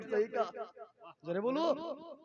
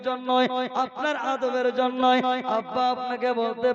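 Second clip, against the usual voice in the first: loud, chanting sermon delivery; preaching at full force; 3 semitones higher, 14 dB louder than usual. Acted. Preacher telling the crowd about Hasan and Husain, full-force preaching. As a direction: preach it to the whole gathering.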